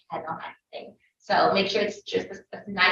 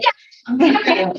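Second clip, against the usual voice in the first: put-on child's reply; 5 semitones higher, 6 dB louder than usual. Child answers teacher.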